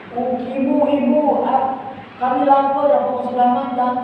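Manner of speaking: put-on high, whining hungry child begging; whiny child. Child whining to mother for food. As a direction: whimper like a hungry little kid.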